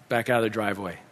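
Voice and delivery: low, soft repeat of an order; subdued, obedient echo; quiet echo. Retold or imitated. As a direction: repeat it softly to yourself as you comply. Dad obediently echoes the direction.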